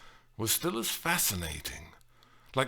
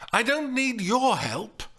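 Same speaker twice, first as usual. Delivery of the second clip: high, loud indignant refusal; heated, high-pitched retort; angry rebuff; 12 semitones higher, 5 dB louder than usual. A narrator's reading. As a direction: push him away sharply. Woman rebuffs him angrily.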